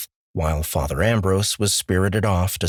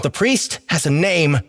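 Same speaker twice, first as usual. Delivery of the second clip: higher, pointed reply; raised pointed correction; pointed retort; 9 semitones higher, 3 dB louder than usual. Acted. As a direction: correct him sharply. Character retort.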